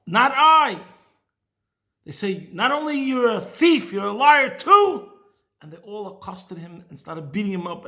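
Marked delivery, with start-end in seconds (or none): 0.1-0.8 much louder, higher insistence; loud denial. Retold or imitated. Accused man denies again. 2.5-5.1 louder, higher, slowing on liar; angry accusation. Retold or imitated. Crowd accuses the stranger.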